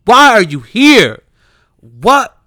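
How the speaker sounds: loud, high exasperated demand; exasperated shout. Real, not acted. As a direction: yell it in disbelief. Exasperated outburst at the singer.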